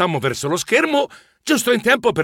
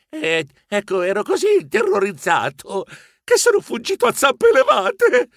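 small, high, frightened confession; sheepish murmur; 7 semitones higher than usual. A narrator's reading. Narrator confesses he fled, small and timid. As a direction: shrink as you admit you ran. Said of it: "diventai piccolo piccolo e sussurrai".

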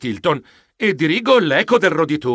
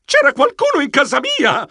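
much higher, panicky exclamation; alarmed; 12 semitones higher than usual. Acted. Geronimo realizing someone broke in, alarmed cry. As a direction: blurt it out in alarm. Realizes intruders are home.

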